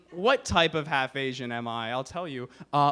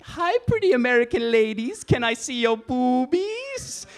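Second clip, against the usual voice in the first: leer at the American ladies in a put-on voice; horny dad caricature; 9 semitones higher, 6 dB louder than usual. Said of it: "like you're a horny guy".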